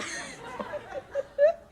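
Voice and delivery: high, real burst of laughter; embarrassed laughter. Real, not acted. Student who lost the point, laughing. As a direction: laugh at losing the thread.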